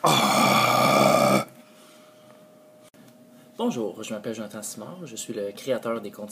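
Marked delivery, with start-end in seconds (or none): 0.0-1.4 exasperated outburst. Real, not acted. Creator botching another take, exasperated outburst. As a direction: blurt it out, fed up with yourself. Flubs the intro yet again.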